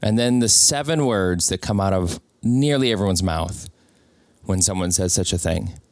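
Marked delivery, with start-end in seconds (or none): none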